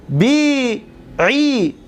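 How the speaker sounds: بیعی stretched very slowly; slow modelling of بیعی; drawn-out بیعی. Shown as a demonstration. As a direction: hold the ending of بیعی for the class. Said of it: "conjugation drill".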